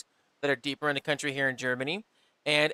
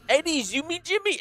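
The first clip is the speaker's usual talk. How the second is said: mock confused local; 12 semitones higher than usual. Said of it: "imitated confused German local".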